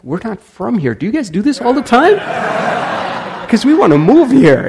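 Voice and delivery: high, loud amazed tourists; excited high voice. Retold or imitated. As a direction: gush like delighted visitors. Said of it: "They're like".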